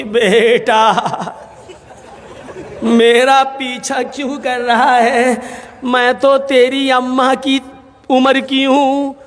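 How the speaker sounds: put-on slow, drawn-out old lady; teasingly slow reply to a young pursuer; old woman. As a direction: stretch each word like an elderly mother. Old woman unveils, addresses her pursuer.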